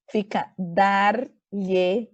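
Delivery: "dar-lhe-ia" very slowly, pieces apart; "dar-lhe-ia" drawn out; slow mesoclisis. Shown as a demonstration. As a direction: spell out "dar-lhe-ia" for students. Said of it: "Como é que fica?".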